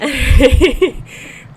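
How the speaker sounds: loud high laugh; burst of laughter. Real, not acted. Laughing at baby joke.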